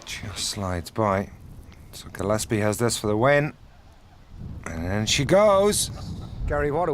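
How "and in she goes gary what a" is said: excited call. Real, not acted. Putt drops for the win.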